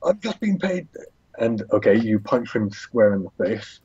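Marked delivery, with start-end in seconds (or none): 0.0-0.9 high, anxious acted plea; acted pinned man making excuses; pleading surrender. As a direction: protest innocence in a high voice. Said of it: "He's like".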